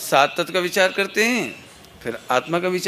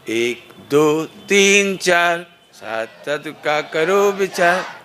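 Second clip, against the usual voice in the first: drawn-out count and slogan; slow call-out slogan; loud and slow; much slower than usual. Shown as a demonstration. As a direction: stretch the words slowly while calling. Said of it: "मैं जोर से धीरे-धीरे बोलूँगा".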